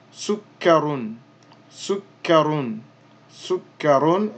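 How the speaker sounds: "sukkarun" drawn out slowly, doubled k held. Shown as a demonstration. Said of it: "So here we would say".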